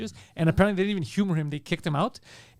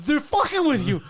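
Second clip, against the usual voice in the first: mock exclaim; 9 semitones higher, 5 dB louder than usual. Clinic worker retold, loud high.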